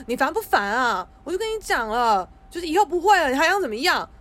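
loud, fast, fed-up snapping; exasperated outburst. Pretend. Hypothetical snap at the boyfriend, loud and exasperated. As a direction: blow up at him like you've had enough. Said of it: "我也不会觉得说".

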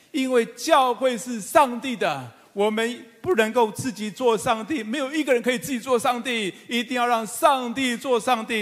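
high, loud, ringing sermon proclamation; fervent pulpit climax, every phrase pushed up; preaching at full force. Acted. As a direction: proclaim it to the whole congregation. Preaching build.

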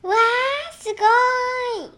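high, bright "わー" of wonder; delighted child. Acted. Atchan seeing the giant cake, high delighted child voice. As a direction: gasp with joy at the huge cake.

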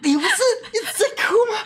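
high, fast laughing disbelief; exasperated laughing outburst; incredulous laughing retort. Retold or imitated. Narrator protesting at the toddler, high and fast.

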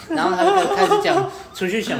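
laughing high through the words. Real, not acted. Telling friends how she began pitching, laughing high. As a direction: giggle as you tell it.

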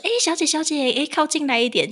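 high friendly hailing call; calling out to a stranger. Retold or imitated. Host imitating herself hailing a pregnant woman, high and friendly. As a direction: wave a stranger over, brightly.

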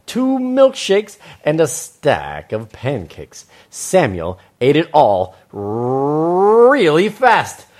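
slow, stretched words with big swoops; animated children's storytelling. Acted. Host reads to children, exaggerated storytelling. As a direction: chomp through the eating noises, loud and rising.

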